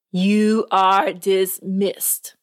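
very slow, final judge's word; slow, heavy judge closing the session; stern dismissal. Acted. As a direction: drag out the dismissal like a verdict.

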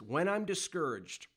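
declaring aloud. Shown as a demonstration. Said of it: "I'm just gonna say it".

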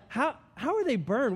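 high, stammered worried question; comically paranoid patient; mock alarm. Acts out anxious reaction to chat.

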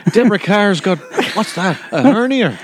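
put-on high playful Indian accent; mock Indian accent, laughing. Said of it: "is that your Indian accent again?".

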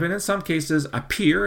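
appear lifted high and leaned on; stress on appear. Real, not acted. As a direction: hit the word appear hard. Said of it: "and I stress the word appear".

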